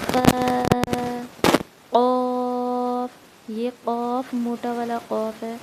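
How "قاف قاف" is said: heavy throat qaaf; throaty qaaf. Shown as a demonstration. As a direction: push قاف from the throat, slowly. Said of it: "حلق سے نکالیں گے".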